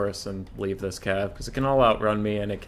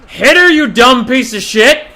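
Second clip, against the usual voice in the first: furious, much higher and louder; angry yell; 11 semitones higher, 14 dB louder than usual. Real, not acted. Gamer screaming at his own hero, furious. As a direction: yell abuse at a useless unit. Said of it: "I'm sorry for screaming".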